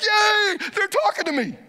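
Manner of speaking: a gleeful Yay from God; excited God voice. Pretend. Pastor voicing God thrilled by prayer, squealing delighted. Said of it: "he's like".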